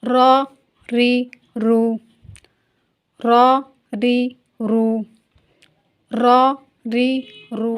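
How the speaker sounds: Urdu: آہستہ، پُر ادائیگی; heavy ra drills. Shown as a demonstration. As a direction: drill each syllable slowly and heavily. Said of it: "اسی طرح سے".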